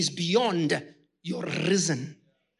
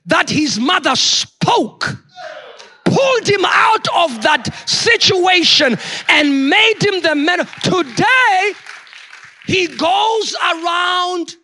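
louder, higher, slow preaching shout; full-force preaching; 9 semitones higher, 12 dB louder, slower than usual. Acted. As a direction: thunder it out to the congregation.

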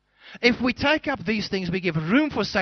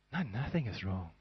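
softer, sweetly acted denial; Hollywood star act; 9 semitones lower, 12 dB quieter than usual. Shown as a demonstration. Preacher shows a hurt churchgoer's masked denial, softer and sweetly acted. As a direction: play it like a Hollywood actor hiding hurt. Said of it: "You say it with a, a great actor, a Hollywood star".

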